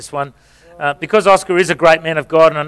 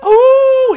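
a sudden very high ooh, much higher; high mock cry; 5 dB louder than usual. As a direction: show the actor how to cry out. Models crying out for actor.

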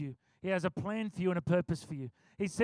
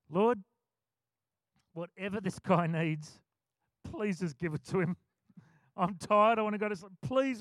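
usual speaking voice

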